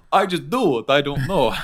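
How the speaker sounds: imitating a friend's Dracula-style German accent, lightly; mock Dracula accent. Retelling a friend's impression of the singer in a mock Dracula accent. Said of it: "his [name] impression is like Dracula".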